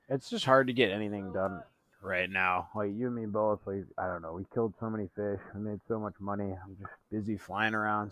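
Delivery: distant dreamy voice from offstage; ethereal voice. Acted. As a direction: drift in low and far away. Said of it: "Who's that ethereal voice?".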